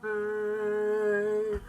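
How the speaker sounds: a high far-off Canadian "Eh", called out across the cliffs; playful Canadian call. Retold or imitated. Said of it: "another Canadian somewhere else".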